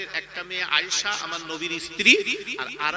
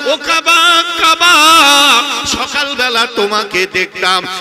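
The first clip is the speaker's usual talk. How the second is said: tearful farewell lament; 3 semitones higher, 16 dB louder than usual. Acted. The Prophet bids the Kaaba goodbye before leaving for Medina, loud tearful lament. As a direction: wail it out as a preacher's sung lament. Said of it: "নবী কাঁদে আর বলে".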